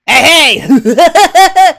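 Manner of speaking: loud, high clown shout; silly clown. Retold or imitated. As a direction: yell it like a goofy clown.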